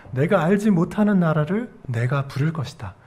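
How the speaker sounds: ordinary talk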